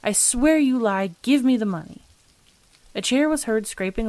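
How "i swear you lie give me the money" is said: angry demand. A narrator's reading. Father demands the money from his son, angry insistence. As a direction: insist forcefully, higher and louder.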